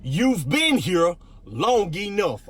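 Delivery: very slow, with "been here" and "long enough" each punched; emphatic announcement. Real, not acted. Pastor reveals today's encouragement topic, "long enough" slow and emphatic. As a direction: land "long enough" slowly and firmly.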